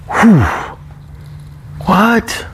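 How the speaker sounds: high-pitched disbelief; amazed. Acted. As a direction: marvel in separate exclamations, with long pauses between. Amazed at ten grand a month.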